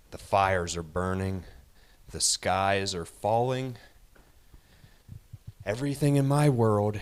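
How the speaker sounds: building from firm to a fast shout; rising rant. Acted. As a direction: whip yourself into a frenzy.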